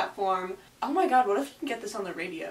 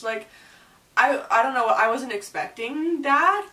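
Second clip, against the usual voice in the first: ordinary talk, nothing marked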